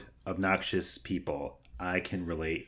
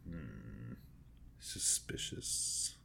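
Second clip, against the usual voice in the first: hushed, slow whisper; suspicious whisper; 6 semitones lower, 14 dB quieter, much slower than usual. Pretend. Player suspects the lone moblin, whispering. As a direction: whisper it slowly, full of mock suspicion.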